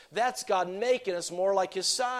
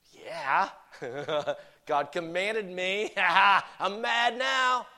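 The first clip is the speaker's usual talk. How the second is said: mock gleeful anger; 3 semitones higher, much slower than usual. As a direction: crow it as if thrilled to be allowed anger. Acts out gleeful obedience to be angry.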